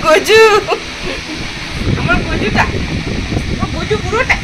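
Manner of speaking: high, soft cooing to a pet. Real, not acted. Owner cooing high and soft at her pet bird Gaju.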